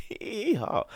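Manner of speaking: a squeaky high hee hee ha; high mock giggle. Shown as a demonstration. Showing how he laughs at pranks, in a squeaky mock giggle. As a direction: toss out a silly high-pitched laugh. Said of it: "I give my laughs out".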